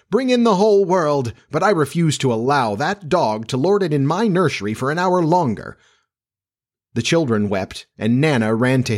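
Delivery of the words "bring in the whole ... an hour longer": high, defiant declaration; reckless. A narrator's reading. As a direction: proclaim it heedlessly, indignantly. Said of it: "he answered recklessly".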